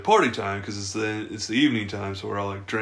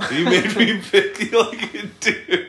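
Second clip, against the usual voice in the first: slow, high, laughing through it; 5 semitones higher, 4 dB louder, slower than usual. Real, not acted. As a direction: laugh as you tell it. Laughing while telling.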